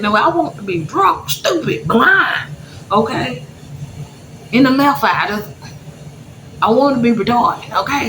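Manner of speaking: high-pitched, insistent rant in short bursts; exasperated outburst. Retold or imitated. Grieving mother demanding to be drunk, exasperated. Acted frantic thought about getting drunk.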